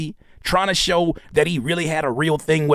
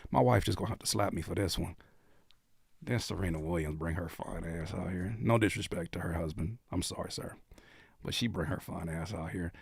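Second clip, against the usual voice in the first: low, very soft confession; hushed guilty admiration; 8 semitones lower, 13 dB quieter than usual. Real, not acted. Host admiring Serena, wife might slap him, hushed. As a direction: keep it down so no one overhears.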